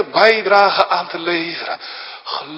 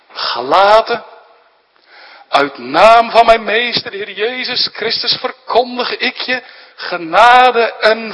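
raised, ringing pulpit voice; fervent preaching; 5 semitones higher, 4 dB louder than usual. Acted. Preacher proclaims grace to his congregation, full-voiced preaching. Preaches Paul's greeting forcefully.

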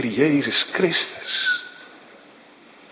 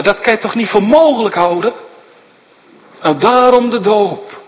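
higher, louder urging pulpit voice; rising preaching; 8 semitones higher, 4 dB louder than usual. Acted. Preacher pressing the wonder of baptism, urgent.

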